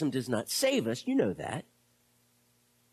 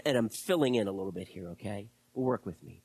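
usual speaking voice

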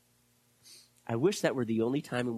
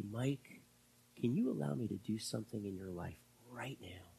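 God's whisper; 2 semitones lower, 9 dB quieter than usual. Pretend. God quietly asking Mike to let go, whispered. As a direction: breathe the question gently. God speaking softly to him.

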